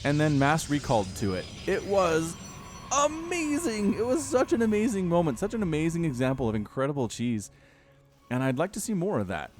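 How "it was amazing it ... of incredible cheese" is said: gushing amazement. Real, not acted. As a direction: gush with high, stretched-out wonder. Gushing over a remembered cheese play.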